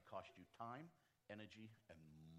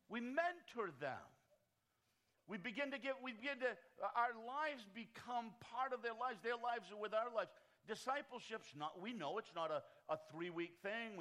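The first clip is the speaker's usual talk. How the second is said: raised, louder preacher's cadence; preaching at full force; 8 semitones higher, 7 dB louder than usual. Acted. Pastor rallying church leaders, preaching at full force. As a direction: drive it home like a pulpit sermon.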